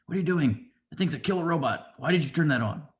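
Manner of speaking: imitating an alarmed warrior scolding her. Mandalorian scolding the frog lady.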